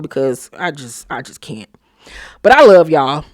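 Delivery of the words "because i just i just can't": softer and lower, giving up; exasperated trailing off. Real, not acted. Host too fed up to grade the episode, trailing off softly. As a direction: sigh out that you just can't.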